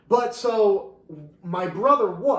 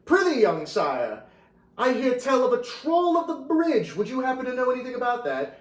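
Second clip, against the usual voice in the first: medieval character; 8 semitones higher than usual. Acted. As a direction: play a storybook noble greeting a stranger. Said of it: "and I'm playing the character".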